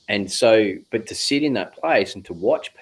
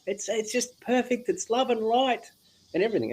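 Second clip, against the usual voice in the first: much higher, airy mock love-and-light voice; blissed-out spiritual type; 13 semitones higher than usual. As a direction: parody the everything-is-perfect crowd, floating high. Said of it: "I could go completely opposite and go".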